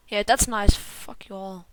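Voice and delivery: ordinary talk, nothing marked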